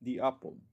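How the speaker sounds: "the apple" with "the" said as "di"; "the" before a vowel sounds "di"; English pronunciation demo. Shown as a demonstration. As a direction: say "the apple" with "the" as "thee". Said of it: "otro ejemplo".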